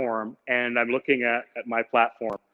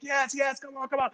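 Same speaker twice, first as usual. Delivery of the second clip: urging stranger; 10 semitones higher, 7 dB quieter than usual. Retold or imitated. Stranger urging traveler onto motorcycle, high and insistent. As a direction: imitate a man hurrying you onto a bike.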